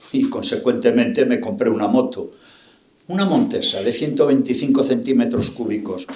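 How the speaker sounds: ordinary talk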